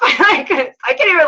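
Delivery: breaking into a high laugh. Real, not acted. Coach recalling her shock to her team, laughing. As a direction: laugh it out, too giddy to finish.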